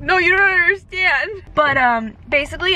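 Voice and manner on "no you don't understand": higher, insistent plea; earnest protest. Retold or imitated. Patient insisting to the surgeon, higher and earnest. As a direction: press it home emotionally. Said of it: "And I'm like".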